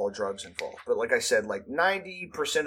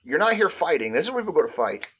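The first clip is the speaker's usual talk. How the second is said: loud, high-pitched incredulous challenge; acted-out indignant questioning; mock confrontation; 7 semitones higher, 6 dB louder than usual. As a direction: demand to know what they're doing here. Acted confronting of loitering kids.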